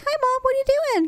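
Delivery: put-on very high child's voice; Olivia. Mother imitating her daughter interrupting her smoke, high-pitched. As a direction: imitate a daughter chirping at mom. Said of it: "Olivia will come outside".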